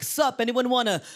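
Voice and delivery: loud, high-pitched skater dude. Acted. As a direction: hype up the crew like a hammed-up skater.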